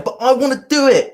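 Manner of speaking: put-on the emotional mind as a sulky kid who wants his way; whiny kid. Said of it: "It turns into a kid".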